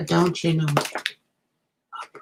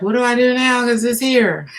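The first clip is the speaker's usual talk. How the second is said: higher and louder panicked question; mock flustered viewer; 7 semitones higher, 7 dB louder than usual. Reader voicing the viewer overwhelmed by answered prayers, mock panic. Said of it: "So you've been like".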